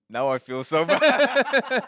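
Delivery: high, loud, stretched-out laughing wail; mock guilt. Commenter learning of his faraway mom, laughing mock guilt. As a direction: wail it while cracking up.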